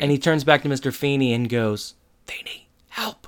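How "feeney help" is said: slow, hushed cry for help; whispered plea. Retold or imitated. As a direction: whisper it like a desperate aside.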